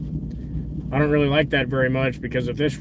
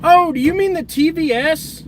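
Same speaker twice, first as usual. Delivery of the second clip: surprised realization; 11 semitones higher, 6 dB louder than usual. Real, not acted. Realizing what chat meant.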